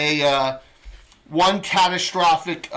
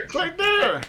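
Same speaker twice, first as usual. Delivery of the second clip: high-pitched confident adventurer; character voice; 13 semitones higher, slower than usual. Acted. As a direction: pitch it up and sound sure. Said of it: "he's like".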